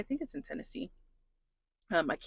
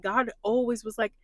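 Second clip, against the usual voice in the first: ordinary talk, nothing marked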